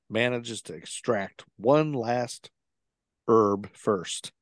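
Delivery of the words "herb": "herb" said as "erb", no H; silent H in herb. Shown as a demonstration. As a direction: drop the H on "herb". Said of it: "Not herb".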